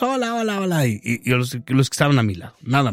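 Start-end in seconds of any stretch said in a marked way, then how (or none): 0.0-0.9 put-on greeting kids from afar. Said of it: "saludaba de lejos a los niños".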